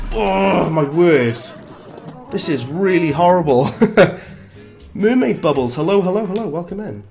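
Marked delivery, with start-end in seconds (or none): none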